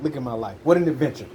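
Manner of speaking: normal talking voice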